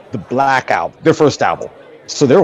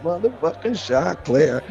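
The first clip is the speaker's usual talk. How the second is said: slow drawn-out greeting; 4 semitones higher, 3 dB quieter, slower than usual. Retold or imitated. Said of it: "when he went and said".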